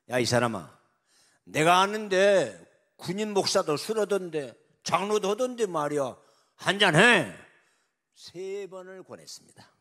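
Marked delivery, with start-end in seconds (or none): 0.1-7.3 loud, higher, bossy coaxing; pushy loud urging. Acted. President loudly urging the general to drink. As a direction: push the drink on him loudly.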